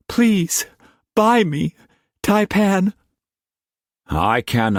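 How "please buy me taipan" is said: put-on high, steady young woman's plea; young woman pleading. A narrator's reading. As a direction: ask him plainly, high. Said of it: "first plea to Struan".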